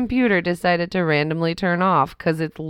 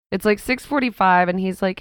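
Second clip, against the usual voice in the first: ordinary delivery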